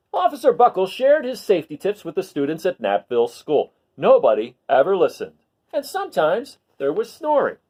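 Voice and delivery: put-on lively read-aloud for children; storybook reading. Teacher reading a picture book to her class, lively read-aloud.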